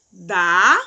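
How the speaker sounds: "dá" said loud and punched, pitch dropping; strong stress on dá. Shown as a demonstration. Teacher showing pupils the strong word "dá" with heavy stress. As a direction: hit "dá" hard as a strong monosyllable. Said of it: "As fortes".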